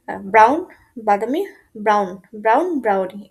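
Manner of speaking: normal talking voice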